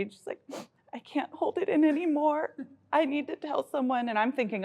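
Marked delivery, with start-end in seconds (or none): 0.9-4.1 high-pitched mock-tearful confession; imitated tearful child. Mother retells her daughter's breakdown, mock-tearful. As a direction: imitate an upset child who can't hold it in. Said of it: "just started crying one day".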